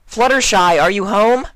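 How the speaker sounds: high, loud call at the door. A narrator's reading. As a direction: call out to a friend through the door. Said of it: "Twilight crowed".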